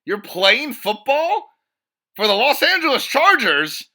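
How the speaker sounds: high, loud fake disbelief at a comeback; mock astonishment. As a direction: gasp out each question as if seeing a ghost. Mock shock at player's comeback.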